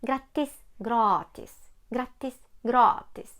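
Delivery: "Grattis" short a, "gratis" long a; short versus long a; short then long a. Shown as a demonstration. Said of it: "коротку «а» ... з довгою «а»".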